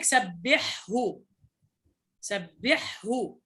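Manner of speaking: "سبحه" slowly, the ha fully breathed before the final ha; clear ha. Shown as a demonstration. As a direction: draw out "سبحه" so the throat ha stays distinct. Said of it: "هذه الحاء تظهر".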